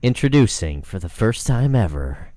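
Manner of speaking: slow, booming trailer announcer; movie trailer voice. Pretend. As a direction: drag it out like an epic movie trailer. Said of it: "Don't do it in your movie trailer voice".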